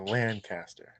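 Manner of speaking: Lancaster as an open "LAN-cas-ter"; Lancaster corrected to "LAN-caster", said softly; native pronunciation. Shown as a demonstration. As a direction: say Lancaster with a full "lan" and a clear "cast". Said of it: "pronounce it this way".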